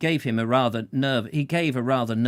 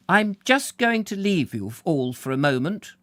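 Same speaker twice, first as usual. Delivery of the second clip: a mildly shaky, higher voice; 7 semitones higher than usual. Acted. Mother leaves the table upset, a touch shaky. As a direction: excuse yourself, trying to hold together. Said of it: "said rather shakily".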